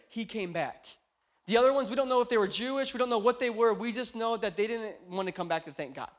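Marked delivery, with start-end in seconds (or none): none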